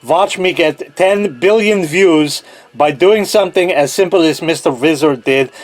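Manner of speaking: hammed-up Russian accent; mock-Russian science show host. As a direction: play a Russian video host in a thick put-on accent. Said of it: "the best of my Russian accent".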